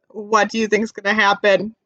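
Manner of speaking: plain reading voice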